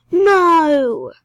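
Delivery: high, loud "no" after a sharp gasp; gasp, then yell it in frustration; mad outburst. Real, not acted. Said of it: "do a different access voice when I'm super mad".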